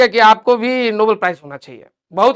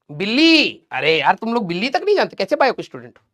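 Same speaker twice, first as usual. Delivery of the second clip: ordinary talk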